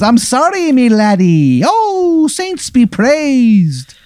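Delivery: very high hammed-up Irish accent; Easy Irish. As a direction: exclaim in a terrible, lilting Irish brogue. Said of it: "this terrible Irish accent".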